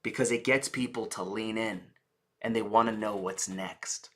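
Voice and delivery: low, soft, confiding tone; dropped voice. Shown as a demonstration. Coach gets listeners to lean in, voice dropped low. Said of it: "why does my tone go lower".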